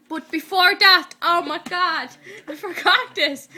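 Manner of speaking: loud, high burst of sudden remembering; excited recollection. Real, not acted. Suddenly remembers a forgotten part.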